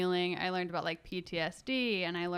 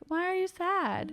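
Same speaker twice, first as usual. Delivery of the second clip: imitating a child's question, much higher; lightly voiced young nephew, pitched up; Kai; 10 semitones higher than usual. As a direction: gently mimic a little boy asking. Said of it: "Kai was like".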